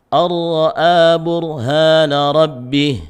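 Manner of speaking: slow melodic tajwid chant; Quran recitation. Shown as a demonstration. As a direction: chant the verse slowly with heavy lam.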